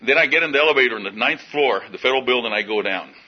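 normal talking voice

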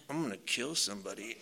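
very low, hushed menacing mutter; barely audible sinister mumble; mumbling to himself. Retold or imitated. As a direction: mutter a threat under your breath, imitating an unhinged guy. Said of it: "mumbling to himself".

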